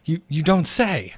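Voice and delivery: drawn-out, slow feigned surprise; mock amazement. Host reacting to co-host's drop in rank, mock amazement. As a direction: play up being astonished, slowly.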